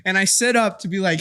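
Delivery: ordinary talk, nothing marked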